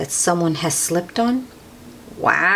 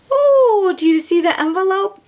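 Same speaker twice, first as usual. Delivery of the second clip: excited oh; 12 semitones higher than usual. Acted. Reader showing the children the envelope, excited. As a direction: burst out pointing at it.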